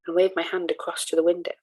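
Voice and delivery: ordinary delivery, nothing marked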